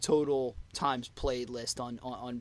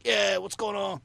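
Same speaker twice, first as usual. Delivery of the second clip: imitated gruff roadie, pitched up; 6 semitones higher, 3 dB louder than usual. Imitating the roadie introducing himself onstage, gruff. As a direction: put on a rough roadie greeting. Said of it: "this real gruff sound".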